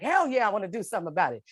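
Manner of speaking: fast, high eager answer; emphatic hell yeah. Retold or imitated. Telling the advocates she wants to fight her arrest, fast eager yes. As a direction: fire back a keen yes, quickly. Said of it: "I was like".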